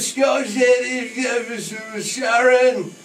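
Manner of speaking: slow, high, loud mumbling [name] shout; [name] impression. Host mimicking the guest's [name]-like voice, slow and loud. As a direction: drawl it out like [name]. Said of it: "I sound like [name] there".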